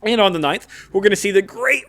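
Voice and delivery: ordinary delivery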